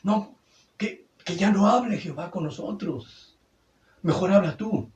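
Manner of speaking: high, loud, alarmed plea not to hear God; frightened Israelites pleading. Acted. Preacher voices the terrified Israelites begging Moses to speak instead, in a high, loud, alarmed plea. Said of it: "Se espantaron los de Israel".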